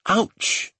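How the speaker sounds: high-pitched cry of pain; a sudden high "ouch"; exclaimed. A narrator's reading. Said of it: "she exclaimed".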